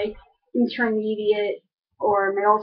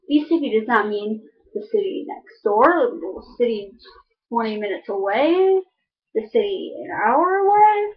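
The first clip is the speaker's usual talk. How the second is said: ordinary talk, nothing marked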